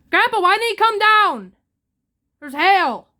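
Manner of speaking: real fear for grandpa; upset that grandpa won't come down, loud and frightened; panicked. Real, not acted. Worried grandpa stays upstairs.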